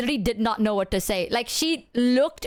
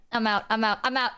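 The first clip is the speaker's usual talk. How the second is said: usual speaking voice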